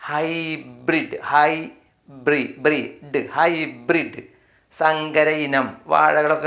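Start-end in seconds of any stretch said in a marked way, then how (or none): 0.1-1.2 slow "ഹൈബ്രിഡ്", long eye vowel in the first syllable; slowed demonstration. Shown as a demonstration. Teacher modelling hybrid for learners, "ഹൈബ്രിഡ്" slow and drawn out. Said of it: "എച്ചിന് ശേഷം വൈ വന്നപ്പോൾ". 1.3-3.2 slow "ഹൈ ബ്രി-, ബ്രീഡ്" in separate pieces; broken into syllables. Shown as a demonstration. Teacher splitting hybrid for learners, "ഹൈ ബ്രീഡ്" slowly in parts. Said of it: "എച്ചിന് ശേഷം വൈ വന്നപ്പോൾ".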